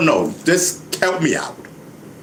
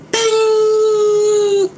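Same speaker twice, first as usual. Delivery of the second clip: high, loud ringing "ding"; sudden sing-out ding; bell ding; 5 dB louder than usual. Acted. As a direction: imitate a bell with a piercing held note. Imitates a bell of realization.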